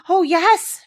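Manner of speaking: high, loud eager oh yes; excited cry. Acted. Colin hearing the lamb, excited high cry. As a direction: burst out with delight. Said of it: "cried Colin, quite flushing".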